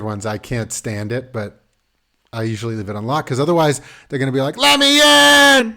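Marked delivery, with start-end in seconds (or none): 4.6-5.8 loud, high, drawn-out mock holler; long shrieked-out demand, playfully acted; imitated kid's yell. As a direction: imitate a child bellowing through a locked door. Kids yelling through locked bathroom door.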